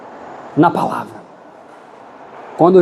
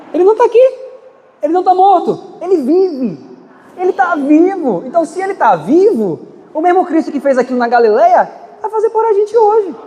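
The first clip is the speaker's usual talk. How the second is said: rising preaching fervor; 8 semitones higher than usual. Real, not acted. The preacher proclaims to the church, higher and fervent. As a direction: cry it out, He is alive. Sermon climax on the risen Christ.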